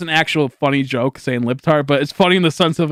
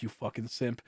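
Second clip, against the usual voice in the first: muttered insult; 5 semitones lower, 19 dB quieter than usual. Retold or imitated. Retold insult, very soft.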